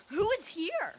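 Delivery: startled gasp. Acted. Puck stumbling on a sleeper, gasping high and loud. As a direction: catch your breath and cry out in alarm.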